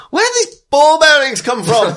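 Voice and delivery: furious shout. Acted. The guard shouting after slipping on the ball bearings. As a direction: yell in disbelief at the mess. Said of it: "a voice hit shouting".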